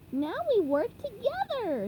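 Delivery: upbeat SpongeBob; exclaiming. Acted. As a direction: exclaim it brightly. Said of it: "he exclaimed".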